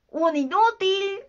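high, drawn-out "Un inútil" as a verdict; slow mocking answer on "Un inútil"; taunting voice. A narrator's reading. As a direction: state "Un inútil" slowly, stretching the insult. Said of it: "retomó la voz".

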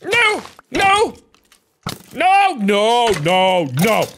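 high, rising panicked yells; panicked shouting. Real, not acted. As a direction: scream at the fire spreading. Sees own fire burning.

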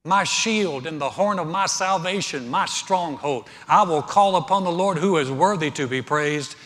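preacher building up through the psalm; preaching at full force. Acted. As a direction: proclaim the psalm with mounting fervor. Psalm quoted with rising force.

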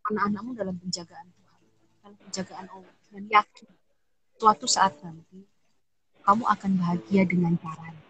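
gentle reassurance. Retold or imitated. Comforting a widowed friend about her children, soft and tender. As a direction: soothe a grieving widow quietly.

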